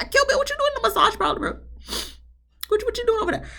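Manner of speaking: high, fast squeak of disbelief; incredulous high voice. Retold or imitated. Recapper quizzing Kill in high, fast disbelief.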